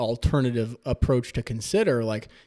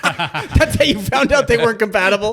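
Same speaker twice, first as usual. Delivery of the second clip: laughing through a question; high and loud, breaking into laughter; 9 semitones higher, 7 dB louder than usual. Real, not acted. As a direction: asks while laughing hard.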